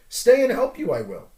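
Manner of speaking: hammed-up stubborn little creature; insisting. Creature refusing to leave Luke, loudly insistent. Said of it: "insisted the creature".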